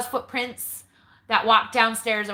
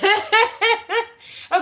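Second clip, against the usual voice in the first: high laugh; 10 semitones higher, 5 dB louder than usual. Real, not acted. Laugh at homeless story.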